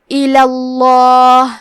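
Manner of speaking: الاللہ chanted slowly, the second ل thick and heavy, its vowel held long; heavy lam. Shown as a demonstration. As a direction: draw out الاللہ with a full, heavy lam in Allah. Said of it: "حرف اللام کو موٹی آواز میں پڑھنا ہے". (الاللہ کا لام موٹی آواز میں، لمبا کر کے)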